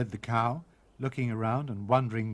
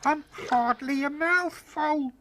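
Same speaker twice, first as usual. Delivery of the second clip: high, loud, slow tiny voice; shouting; 14 semitones higher, 4 dB louder, slower than usual. A narrator's reading. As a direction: shout up from the wolf's belly. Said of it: "shouted Tom".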